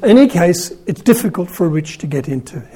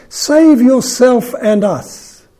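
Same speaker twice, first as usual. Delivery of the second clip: slow, higher, louder jeer; mild mock deriding; 7 semitones higher, 4 dB louder, much slower than usual. A narrator's reading. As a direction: drag out the taunt. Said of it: "kept deriding Jesus".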